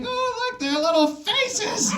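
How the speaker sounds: very high, slow cooing over puppies; gushing high voice. Retold or imitated. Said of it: "you know, like".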